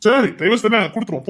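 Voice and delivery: ordinary talk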